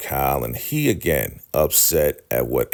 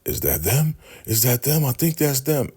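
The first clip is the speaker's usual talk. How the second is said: high-pitched panicky questioning, retold; mock alarm at rising pitch; retold terrified; 10 semitones higher than usual. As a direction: imitate someone scared, pitch climbing with each phrase. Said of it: "he is so terrified".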